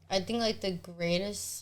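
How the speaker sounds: ordinary delivery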